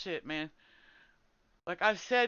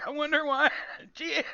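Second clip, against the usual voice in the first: high, louder mock wonder; sarcastic; 7 semitones higher, 5 dB louder than usual. Real, not acted. Streamer mocks the guild to chat, high and sarcastic. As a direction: drip with sarcasm.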